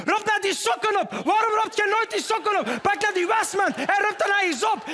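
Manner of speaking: high, mock-furious nagging; hysterical wife. Wife yelling at husband about socks, mock hysterical. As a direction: shout the orders in a shrieking high voice. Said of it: "thuis al een hysterisch wijf".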